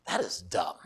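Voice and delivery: low, soft flat verdict; deadpan mutter. Retold or imitated. Pastor reacting to the lady's voting story, quiet deadpan. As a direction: mutter it dryly. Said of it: "I'm like".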